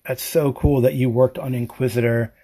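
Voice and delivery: ordinary talk, nothing marked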